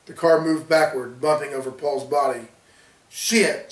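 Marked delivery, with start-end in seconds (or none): 3.1-3.7 sudden, pitched-up curse; exclaiming. A narrator's reading. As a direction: blurt it out in surprise. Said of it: "Justin exclaimed".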